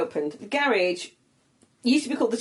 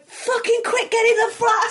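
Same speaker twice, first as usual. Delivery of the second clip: higher, laughing panicked hurry; panicked urgency; 4 dB louder than usual. Retold or imitated. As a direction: urge your mates inside in a rush. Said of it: "and then I went".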